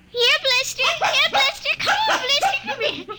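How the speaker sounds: calling the dog. Acted.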